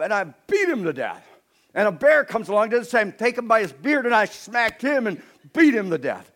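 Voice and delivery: put-on bragging young David. David boasting to Saul about lion and bear, loud and cocky. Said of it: "David says".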